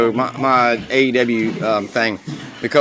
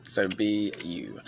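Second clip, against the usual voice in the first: slow, soft sincere advice; gentle encouragement; 7 semitones lower, 14 dB quieter, slower than usual. Real, not acted. Host telling listeners to be themselves, softly and slowly. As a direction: ease off and say it gently.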